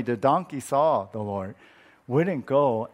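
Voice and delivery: usual speaking voice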